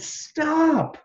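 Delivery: sharp, high yell of Stop; parents yelling stop. Acted. Parents yell at a climbing toddler, sharp high shout. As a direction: bark it out high like alarmed parents. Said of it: "Her parents yelled".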